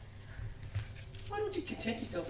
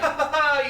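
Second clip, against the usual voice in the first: high, loud burst of laughter; 8 semitones higher, 9 dB louder than usual. Acted. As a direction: laugh out loud at your mate. Amused by the young Australian.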